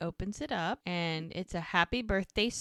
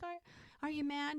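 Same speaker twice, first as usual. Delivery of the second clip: small, high, soft voice; timid question; 8 semitones higher, 11 dB quieter, faster than usual. Retold or imitated. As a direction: ask it gingerly, imitating someone worried. Retold timid question after apology.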